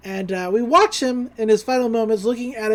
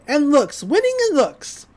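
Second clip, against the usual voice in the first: a much higher, put-on snooty mother voice; chiming-in mother; 5 semitones higher than usual. Mother chiming in to her daughter, high and snooty. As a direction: pipe up high like a vain society mom. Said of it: "mother to chime in and say".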